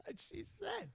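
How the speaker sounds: high, soft, laughed-out words; laughing. Real, not acted. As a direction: squeeze it out through laughter. Cracking up at his own joke.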